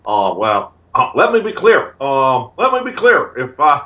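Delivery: slow, deliberate statesman's hedging; Barack Obama. Acted. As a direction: imitate a politician carefully stalling for time. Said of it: "good person test with Barack Obama".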